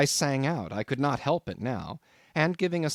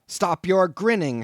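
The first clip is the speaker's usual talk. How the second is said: loud, high angry shout; furious shout, slow and loud; 7 semitones higher, 8 dB louder, slower than usual. A narrator's reading. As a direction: snap back at the grinning landlord. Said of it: "shouted I".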